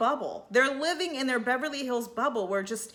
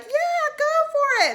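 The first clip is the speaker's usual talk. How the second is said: much higher, louder squeal of permission; squealy go-ahead; 6 dB louder than usual. Retold or imitated. Friends cheering Erica on downhill, squealed high and loud. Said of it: "And they're like".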